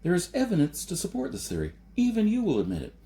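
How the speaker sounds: put-on insistent young man driving the point home; Jason's voice. A narrator's reading. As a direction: retake it as Jason, urgent and quick.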